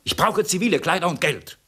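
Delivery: high, loud impatient demand; angry outburst. Acted. Fugitive cuts the woman off, angry and loud. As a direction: cut her off in anger.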